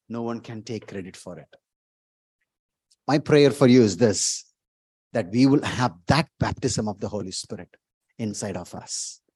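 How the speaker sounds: ordinary talk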